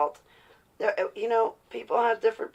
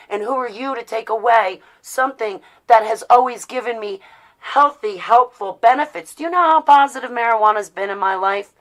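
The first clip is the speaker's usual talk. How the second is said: loud, higher, heated defiance; real anger, telling them off; furious and done being judged; angry rant; 4 semitones higher, 8 dB louder than usual. Real, not acted.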